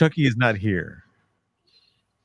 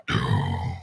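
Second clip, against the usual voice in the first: very low booming growl; doom voice. Shown as a demonstration. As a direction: drop the voice deep and slow for doom. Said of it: "That's the voice that I use into the synthesizer".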